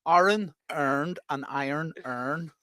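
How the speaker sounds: very slow Northern Irish "Iron earned and iron earned"; careful, slowed Northern Irish "Iron earned and iron earned"; Northern Irish accent. Shown as a demonstration. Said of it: "go say it again".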